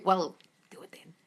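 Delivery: whispered, embarrassed; whispered aside. Real, not acted. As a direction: breathe it out under your breath.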